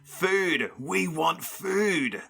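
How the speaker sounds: high, drawn-out monster demand; screaming monster. A narrator's reading. Big monster demanding food, restrained scream. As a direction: stretch it into a high creature cry. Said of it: "the big one screamed".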